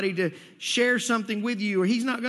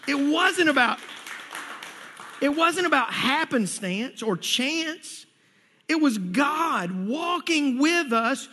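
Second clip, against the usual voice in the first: higher, forceful preacher's delivery; emphatic preaching; 6 semitones higher, 3 dB louder than usual. Real, not acted. Preacher telling congregation God opened the doors, emphatic preaching. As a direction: drive each point home with raised conviction.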